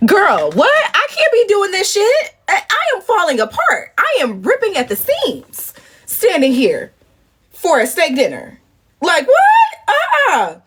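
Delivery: very high outraged squeal; high, crying-laugh complaint; exasperated. Real, not acted. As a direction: wail it like you're falling apart.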